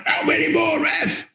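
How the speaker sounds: high, strained shout at the ref; mock scream. Captain screaming out at the referee, high mock yell. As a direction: imitate a player screaming out, pitched right up. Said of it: "Rather than just screaming out".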